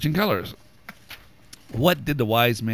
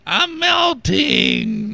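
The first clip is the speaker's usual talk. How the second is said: high, slow, shouted witch cry; melting witch; 10 semitones higher, 9 dB louder, much slower than usual. Pretend.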